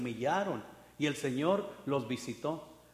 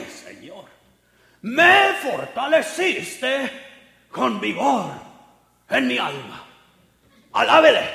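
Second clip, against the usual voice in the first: much higher, much louder, slow drawn-out preaching wail; mock anointed preacher; 7 semitones higher, 12 dB louder, slower than usual. Shown as a demonstration. Preacher parodies the trained anointed pulpit voice, slow and booming. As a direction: ham it up like a showy televangelist. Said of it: "la versión ungida".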